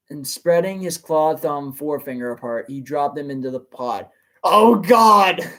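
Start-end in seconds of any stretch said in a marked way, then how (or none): 4.4-5.5 loud horrified cry; horrified exclamation. Real, not acted. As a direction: react in real horror. Reader horrified by story.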